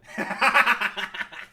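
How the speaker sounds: loud burst of laughter. Real, not acted. Laughing after his bit.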